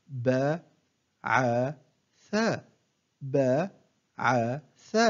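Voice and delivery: one short syllable at a time, with pauses; broken into syllables. Shown as a demonstration. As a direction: separate each syllable for a learner to copy. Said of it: "أقطع الكلمة إلى مقاطع قصيرة". (تقطيع الكلمة مقطعًا مقطعًا بوقفات)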